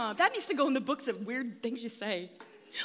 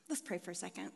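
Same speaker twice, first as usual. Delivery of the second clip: soft, low, intimate praying voice; prayer; 5 semitones lower, 10 dB quieter than usual. Real, not acted. Speaker to the Lord, softly prayerful. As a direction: lead a hushed, tender prayer.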